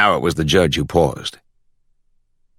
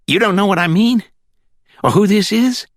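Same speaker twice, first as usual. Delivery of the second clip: put-on country accent from the Glades. A narrator's reading. Caller on speakerphone checking for the judge, high country accent. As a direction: ask it in a high rural Florida accent. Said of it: "With that country accent".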